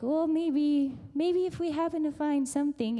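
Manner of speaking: tentative maybe. Retold or imitated. Imagining Ruth hoping to find something, tentative. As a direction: float it as an unsure maybe. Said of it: "It's not like".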